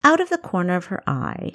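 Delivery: usual speaking voice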